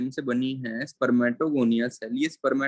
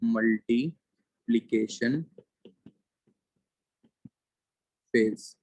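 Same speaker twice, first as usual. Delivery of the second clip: each syllable drawn out very slowly; slow, careful spelling-out pace; slow dictation; much slower than usual. Shown as a demonstration. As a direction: say it slowly so students can write it down. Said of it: "repeats term for dictation".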